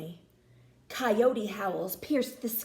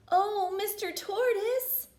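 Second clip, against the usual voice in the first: higher calling voice; called out; 9 semitones higher than usual. A narrator's reading. Danny calling to the tortoise, higher call. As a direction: call out to him from afar. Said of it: "called Danny".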